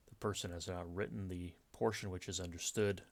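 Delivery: ordinary delivery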